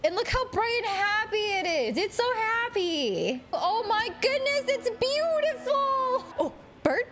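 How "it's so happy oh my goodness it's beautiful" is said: awed. Real, not acted. Admiring the bright world.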